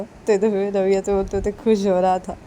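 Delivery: ordinary delivery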